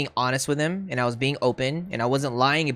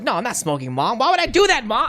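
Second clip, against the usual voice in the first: put-on high, louder teenage protest to mom; super defensive; 9 semitones higher, 4 dB louder than usual. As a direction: act out getting super defensive. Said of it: "I'll get, like, super defensive".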